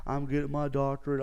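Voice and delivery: bragging, bright and eager; excited boast. Retold or imitated. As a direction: announce it proudly to everyone. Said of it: "Like, so excited".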